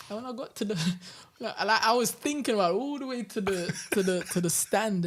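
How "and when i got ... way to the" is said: laughing. Real, not acted. Laughing at his own story.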